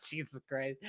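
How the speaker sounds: soft words swallowed by laughter; barely voiced through a laugh. Real, not acted. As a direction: crack up while saying it, very quietly. Cracking up at the retold punchline.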